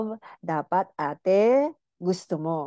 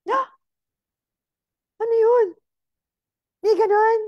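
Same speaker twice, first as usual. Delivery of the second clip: very high, louder gasping disbelief; shocked viewer; 5 dB louder than usual. Retold or imitated. Viewer stunned to hear of clitoral erection, high shocked squeal.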